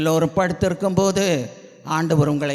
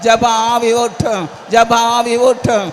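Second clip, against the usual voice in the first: fervent pleading prayer; 5 semitones higher, 7 dB louder than usual. Real, not acted. Preacher crying to Jesus for a spirit of prayer, fervent pleading. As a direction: chant each plea insistently at full voice.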